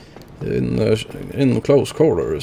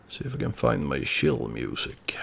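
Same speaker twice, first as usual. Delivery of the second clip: breathy near-whisper, much softer; hushed; 10 dB quieter than usual. Real, not acted. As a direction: drop to a hush as the chill music starts. Settling in with chill music.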